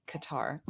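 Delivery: "Qatar" said as kuh-TAR; American-style "Qatar" rhyming with guitar; American pronunciation. Shown as a demonstration. Settling the pronunciation for the co-host, "Qatar" as kuh-TAR. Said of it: "we have American accents".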